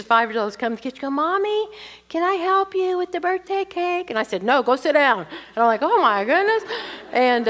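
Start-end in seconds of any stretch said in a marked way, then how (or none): 1.1-4.1 put-on much higher, sweet five-year-old asking; little girl's voice. Little Cindy asking Mommy to help with the cake, high child voice. 4.4-5.2 louder, higher brusque dismissal; curt order. Retold or imitated. Mother sending the little girl away from the cake, louder curt command. 5.8-6.6 much higher, louder dismayed gasp of words; shocked realisation. Retold or imitated. Mother watching the old video of herself, loud dismayed exclamation.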